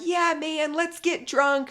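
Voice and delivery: high, goading party-buddy voice; egging on. Retold or imitated. Sarper egging Sumit on to drink, high mock goading. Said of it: "egging Sumit on".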